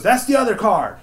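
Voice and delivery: high, loud mock nagging; bossy yelling daughter. Daughter corrects her deaf dad's card choice, yelling. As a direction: imitate yelling instructions at a deaf father.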